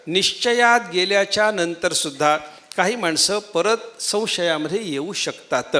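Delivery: ordinary talk, nothing marked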